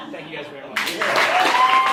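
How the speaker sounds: laughing thanks. Real, not acted. Ending story to applause.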